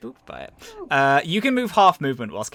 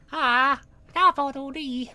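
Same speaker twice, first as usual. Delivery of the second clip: little creature babble; 13 semitones higher than usual. Acted. Game master as Bongus being hugged, high babbling. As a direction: coo gibberish like a tiny creature.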